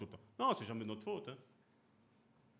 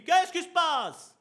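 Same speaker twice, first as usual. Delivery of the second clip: high, loud, slow mock father; father's demand; 11 semitones higher, 9 dB louder, much slower than usual. Father arriving home demanding what's going on, loud and high. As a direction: boom it like the father walking in.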